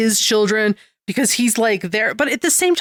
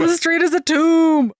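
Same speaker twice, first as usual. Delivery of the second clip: higher-pitched playful echo of doom; melodramatic wail; 7 semitones higher than usual. Pretend. Joining the joke about the street, mock doom wail. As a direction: echo the hammed-up cry, high.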